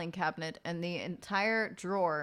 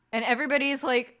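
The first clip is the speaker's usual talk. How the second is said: ordinary talk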